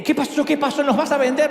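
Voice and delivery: high, alarmed wife's questions, laughing at the end. Acted. Wife on the phone fearing she will be sold, mock panic.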